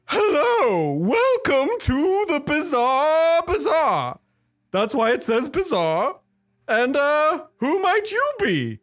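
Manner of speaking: high, loud, drawn-out carnival welcome; showman cat host. Acted. Cat host welcomes newcomers to the bazaar, showy and high. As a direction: greet them like a high-voiced ringmaster. Cat figure greets the party.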